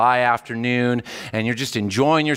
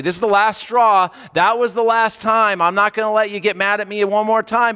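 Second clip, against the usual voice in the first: higher and louder, an exasperated ultimatum; fed-up God; 8 semitones higher, 4 dB louder than usual. Pretend. Preacher mocking a God who is fed up with complaints, exasperated and loud. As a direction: snap like someone whose patience just ran out. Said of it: "He's not gonna say".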